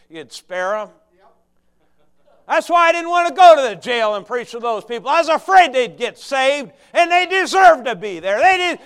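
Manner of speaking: loud, high, driving preaching; preaching at full force. Acted. Preacher voicing Jonah's bitterness to the flock, full force. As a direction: hammer the congregation with it.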